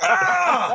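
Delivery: high yelp of a falling thug; pained yell. Acted. Thug slipping on spilled beer, pained yell. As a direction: cry out as you slip and crash. Attacker slips and falls.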